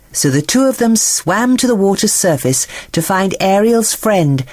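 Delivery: plain reading voice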